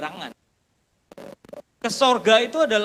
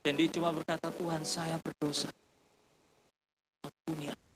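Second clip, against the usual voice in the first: soft, much quieter, reverent telling over music; hushed storytelling; 6 semitones lower, 8 dB quieter than usual. Acted. Preacher tells the congregation of the weeping taxi driver, hushed and reverent. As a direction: draw the room in close and low.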